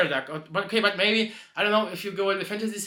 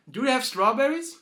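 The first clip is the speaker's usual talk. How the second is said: higher, innocent repeat; just repeat it blankly, higher; horse repeating; 7 semitones higher than usual. Acted. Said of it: "he's just like".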